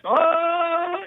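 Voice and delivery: long, high triumphant gorilla holler; imitated gorilla cry. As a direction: mimic a chest-beating gorilla. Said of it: "you know how the gorillas beat their chest".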